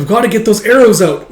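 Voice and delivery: high, loud urgency; urgent exclamation. Acted. Yun rushes to treat wounded friend, urgent.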